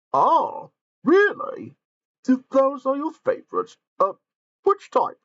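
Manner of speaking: high, loud eager character voice; excited Gordon. Acted. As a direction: squeal it out with delight. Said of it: "asked Gordon".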